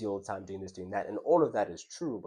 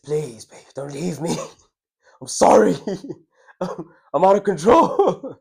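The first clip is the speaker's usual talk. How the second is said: hammed-up pleading, higher and louder, with laughs; mock begging; 9 semitones higher, 13 dB louder than usual. Begging his girlfriend not to leave, hammed-up pleading. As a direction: beg theatrically while breaking into laughter. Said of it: "I begged".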